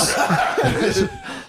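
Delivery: high breathy giggle; high laugh. Real, not acted. Laugh at own story.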